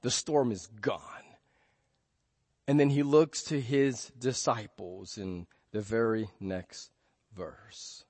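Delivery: usual speaking voice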